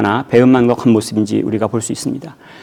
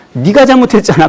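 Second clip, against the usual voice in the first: blaming; 7 semitones higher than usual. Retold or imitated. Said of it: "어떻게 표현합니까".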